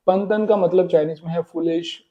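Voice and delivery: ordinary talk, nothing marked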